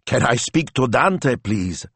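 high, slow, quavering request. A narrator's reading. Prank caller asking for Dante, quavering. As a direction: quaver it slowly like a timid caller. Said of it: "said a quavering voice".

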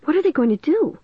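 high, puzzled wondering. A narrator's reading. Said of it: "I wondered".

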